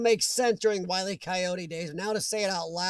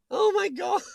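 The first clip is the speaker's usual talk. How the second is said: amazed oh my God; 11 semitones higher, 4 dB louder than usual. Real, not acted. Reacting to Urbexy in chat.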